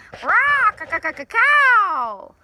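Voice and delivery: high, loud squawking parrot call; raucous parrot squawk. Acted. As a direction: screech it out like a wild parrot. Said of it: "greeted the morning with a raucous".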